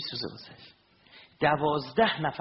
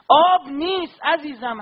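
higher, louder impassioned sermon; fervent preaching; 11 semitones higher, 12 dB louder, slower than usual. Acted. Preacher stirring mourners with Abbas's story, fervent and loud. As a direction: preach it out with fire to the crowd.

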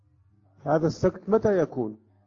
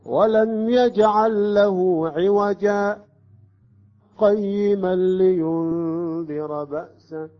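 slow chanted recitation, higher and louder; recitation with sakt; 5 semitones higher, 5 dB louder, much slower than usual. Shown as a demonstration. Teacher repeats the join with the pause. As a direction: chant it, stopping briefly on عوجا. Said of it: "نأتي بالسكت بدون أن نأخذ نفس".

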